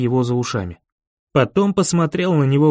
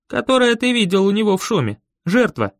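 lightly voiced boy, quicker and higher; higher, quick boyish reply; boy character; 6 semitones higher than usual. A narrator's reading. Boy reminding the narrator of the Noise, lightly voiced higher.

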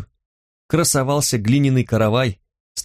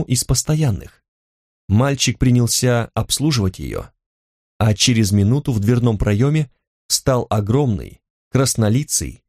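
plain reading voice

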